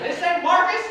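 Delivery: a mock double-take in a high pitch; surprised woman. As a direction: caller realizing she has dialed a stranger. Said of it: "She's like".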